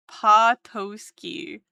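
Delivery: Patoski slow, pa-TOE-ski; slowly sounding Patoski. Shown as a demonstration. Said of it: "T O S key".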